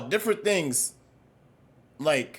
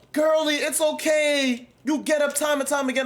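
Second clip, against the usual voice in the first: mock consoling friend; 9 semitones higher than usual. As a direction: soothe her like a hammed-up bestie. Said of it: "people who huddle around the girl".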